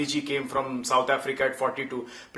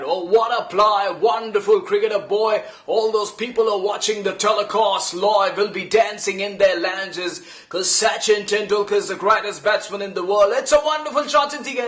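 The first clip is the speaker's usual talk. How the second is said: higher, louder gushing commentator voice; Tony Greig impression; 5 semitones higher, 4 dB louder than usual. Imitating Tony Greig gushing over Sachin from the commentary box. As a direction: hype the batsman like an over-the-top TV commentator. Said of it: "I did an imitation of Tony Greig praising Sachin Tendulkar".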